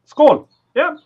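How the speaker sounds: loud toast. Real, not acted. Toasting the chat.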